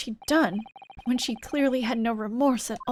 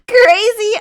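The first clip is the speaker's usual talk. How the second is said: high loud yelp of Crazy; excited shout; 11 semitones higher, 14 dB louder than usual. Acted. Character reacts to the joke with a loud high yelp. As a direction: burst out with it.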